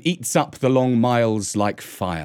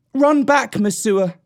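raised, high call; urgent call; 9 semitones higher, 3 dB louder than usual. A narrator's reading. Mowgli sends Mesua back to the village, calling out. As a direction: call out firmly, sending her away.

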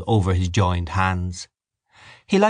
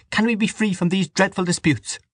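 pleading mother; 11 semitones higher than usual. A narrator's reading.

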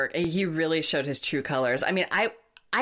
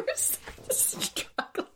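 laughing whisper; 7 dB quieter, slower than usual. Real, not acted. Laughing while host coughs.

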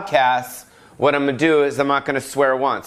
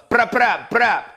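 high punchy brap noises; imitated gunshots; 8 semitones higher than usual. Host hypes [name]'s nightclub with gun noises. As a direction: fire off mock gun sounds.

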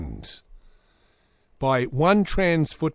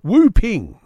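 name as a cough; 6 semitones higher, 8 dB louder than usual. Pretend.

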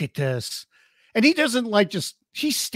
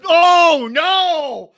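high, loud gasp of alarm; alarmed cry; 10 semitones higher, 6 dB louder than usual. Real, not acted. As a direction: cry out in real shock. Lita hit with chair.

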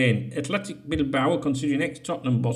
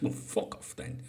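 muttered aside; 13 dB quieter than usual. Real, not acted. Dismissive aside about Tarkowski.

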